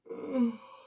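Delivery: big sleepy yawn. A narrator's reading. Eddie yawns after being woken early, a yawn. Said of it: "yawned Eddie".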